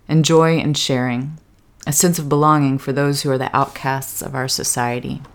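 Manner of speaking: reading a quote aloud. Retold or imitated. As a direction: read it out deliberately, giving each phrase weight. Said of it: "She writes".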